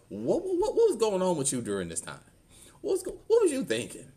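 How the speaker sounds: high-pitched, insistent retold questions; retold questioning. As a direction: press him for answers, pitched well up. Questioning his father about the past.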